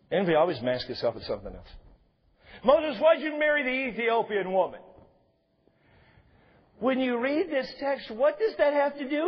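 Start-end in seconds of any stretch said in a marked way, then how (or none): 2.6-4.8 pointed, raised accusing demand; mock Miriam hurling the charge; accusing question. Miriam confronting Moses, raised and accusing.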